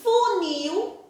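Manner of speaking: "funil" slowly, fu-NIL, last syllable held; stress on NIL. Shown as a demonstration. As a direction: sound out "funil", leaning on the final "nil". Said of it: "o nil é a sílaba mais forte". ("funil" devagar, com a sílaba "nil" forte)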